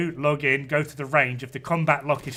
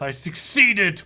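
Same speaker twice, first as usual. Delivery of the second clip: triumphant, louder gloat; 4 semitones higher, 6 dB louder, slower than usual. Acted. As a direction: crow it like a proud villain. Gloating punchline.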